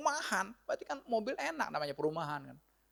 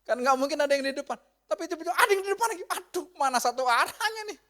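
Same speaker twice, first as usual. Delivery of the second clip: high, loud, exasperated outburst; mock alarm; 10 semitones higher, 6 dB louder than usual. Driver meeting oncoming car on one-way road, alarmed. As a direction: act out dismay at an oncoming car.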